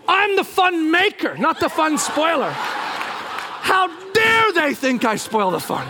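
high, slow, wounded pride; indignant huff. Retold or imitated. Husband fuming on the path, indignant huff. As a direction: protest with mock outrage. Indignant thoughts while storming off.

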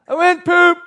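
put-on high, loud little-kid voice; imitated child hollering from the bathroom; small child announcing. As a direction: call it out like a proud toddler. Small son answers his father.

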